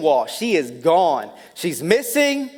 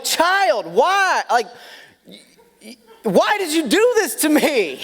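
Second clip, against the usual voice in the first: high, pleading mock despair at the child; exasperated parent; 8 semitones higher than usual. Dad pleading with his hiding toddler, exasperated and high. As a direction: whine it out like a fed-up dad. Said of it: "the other part of me is like".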